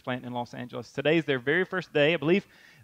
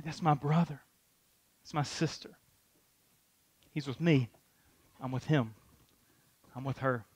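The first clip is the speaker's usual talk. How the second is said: tender; 3 semitones lower, 7 dB quieter than usual. Acted. Jesus voiced, arm around you.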